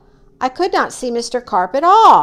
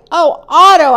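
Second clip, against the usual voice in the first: higher, louder cry of dismay; 6 semitones higher, 7 dB louder than usual. A narrator's reading. Boy to his ever-growing fish, dismayed. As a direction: exclaim in alarm at Otto.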